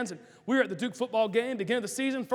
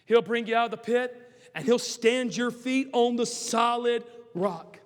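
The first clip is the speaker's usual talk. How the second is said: usual speaking voice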